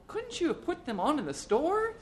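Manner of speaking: very high, reproachful woman's voice; nagging wife. A narrator's reading. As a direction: scold the husband for his foolishness. Wife scolds husband over the overshoes.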